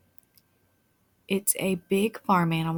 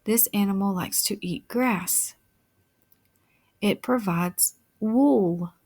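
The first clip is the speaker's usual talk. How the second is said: ordinary delivery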